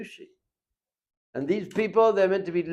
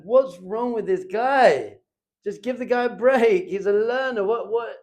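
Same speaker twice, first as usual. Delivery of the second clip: louder and higher, slowed frustrated grumble at a driver; mock road rage; 7 semitones higher, 5 dB louder, slower than usual. Rabbi retells grumbling at honking driver, mock annoyed. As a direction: vent at the beeping driver. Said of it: "So I was like".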